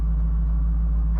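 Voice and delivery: long breathy sigh of relief; deep relieved sigh. Real, not acted. Owner after fixing the engine, deep relieved sigh. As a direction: let out the relief.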